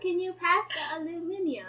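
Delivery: amused test of British "aluminium"; trying British pronunciation. Shown as a demonstration. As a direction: try out "aluminium", ending in -ee-um. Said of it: "why does that sound way longer".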